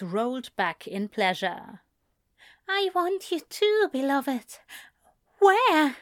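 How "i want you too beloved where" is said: high-pitched lover's voice; lover's reply. A narrator's reading. Aziraphale answering his beloved's desire, high lover's voice. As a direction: raise the voice high, eager on the question.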